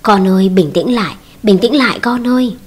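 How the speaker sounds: usual speaking voice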